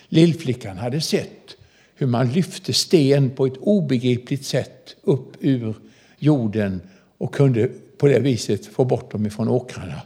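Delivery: ordinary talk, nothing marked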